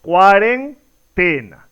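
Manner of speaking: "cuarentena" split as cuaren, TEna, very slow; slow, broken "cuaren... TEna"; correct stress on TE. Shown as a demonstration. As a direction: pause inside "cuarentena", then land on TE and fall. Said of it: "la pronunciación correcta es".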